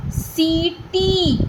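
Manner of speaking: "सीटी" very slowly and softly, both ee vowels held long; "सीटी" in two long syllables; stretched ee. Shown as a demonstration. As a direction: draw out "सी", then "टी". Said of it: "सा को भी हम खींच रहे हैं".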